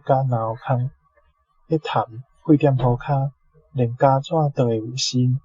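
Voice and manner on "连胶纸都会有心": ordinary delivery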